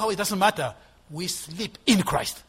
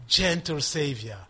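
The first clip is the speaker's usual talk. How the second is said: ordinary talk, nothing marked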